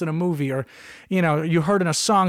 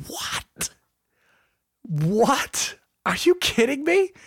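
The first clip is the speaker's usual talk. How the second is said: stunned then rising into high disbelief; shocked disbelief; 8 semitones higher, much slower than usual. Retold or imitated. Patient hearing the doctor's diagnosis, stunned and incredulous. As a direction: react like you can't believe the news.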